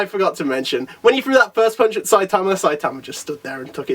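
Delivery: ordinary talk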